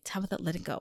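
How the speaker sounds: quiet gentle command; hushed gentle instruction; God quietly telling her to let go; soft God voice. Pretend. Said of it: "him just being like".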